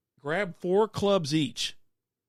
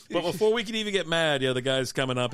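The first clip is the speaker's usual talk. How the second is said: usual speaking voice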